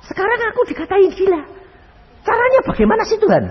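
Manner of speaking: high, fast, loud whining plea; exasperated complaint. Retold or imitated. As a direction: complain to God, rushed and fed up. Mengeluh kepada Tuhan. (mengeluh cepat, tinggi dan keras)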